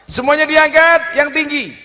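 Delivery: high, loud command to a crowd; calling out. Real, not acted. Preacher telling everyone to raise phones, loud call. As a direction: project it to the back rows.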